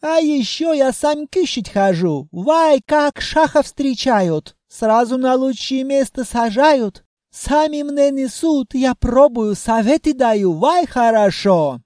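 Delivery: put-on hammy bragging Caucasian accent. Djinn boasts in broken accent.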